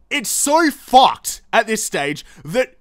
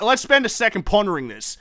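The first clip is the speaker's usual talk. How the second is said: ordinary talk, nothing marked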